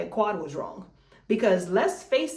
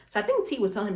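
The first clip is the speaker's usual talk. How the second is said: usual speaking voice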